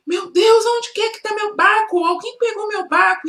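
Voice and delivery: high, rising panic over the lost boat; frantic boy's cry; desperate panic. A narrator's reading. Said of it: "Ele ficou desesperado".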